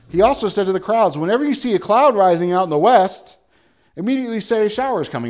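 loud, fervent preaching of scripture; preaching at full force. Acted. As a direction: proclaim it to the back pews. Preacher reading Luke aloud.

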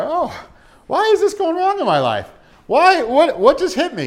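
high, loud mock wail of the clueless; bewildered mock lament. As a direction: whine it like someone blindsided.

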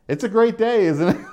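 high, loud, sing-song smug cheer. Retold or imitated. Man needling his cursing neighbor, smug and cheery.

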